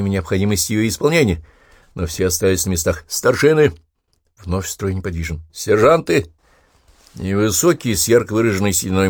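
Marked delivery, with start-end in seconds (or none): 3.1-3.7 shouted command. A narrator's reading. Officer calls out rank. 5.5-6.3 shouted command. A narrator's reading. Officer calls out rank.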